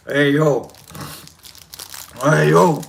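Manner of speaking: low Philly "Hey, yo"; warming up into a Rocky impression. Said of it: "trying to do a Rocky".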